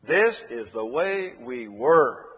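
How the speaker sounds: slow and deliberate, landing on "were"; stress on were. Shown as a demonstration. Preacher contrasting past hatred with now, slow stress on "were". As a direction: draw out each word up to an emphatic "were".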